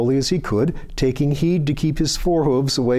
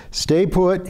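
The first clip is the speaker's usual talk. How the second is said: higher, crisp command to stay still; firm order; 7 semitones higher than usual. A narrator's reading. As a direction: tell her to stay put, firmly. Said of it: "he ordered".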